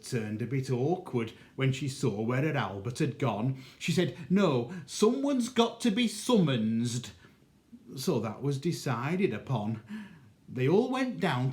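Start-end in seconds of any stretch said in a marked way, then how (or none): 4.3-7.1 stubborn mother. Acted. Mother refuses the money, higher stubborn insistence. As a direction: dig in, insisting on a summons.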